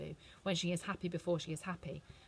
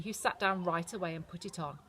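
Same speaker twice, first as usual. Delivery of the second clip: plain reading voice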